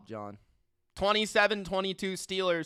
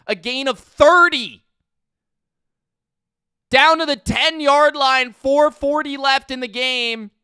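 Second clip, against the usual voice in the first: louder mock broadcaster play-by-play, the voice rising; play-by-play call; 5 semitones higher, 9 dB louder than usual. Streamer calling the Bills drive for his viewers, broadcaster style.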